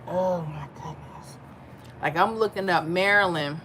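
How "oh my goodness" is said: breathy whisper, slow; whispered exasperation. Retold or imitated. Telling a viewer how tired some nights make her, whispered exasperation. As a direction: whisper it like you're worn out. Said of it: "And I'm like".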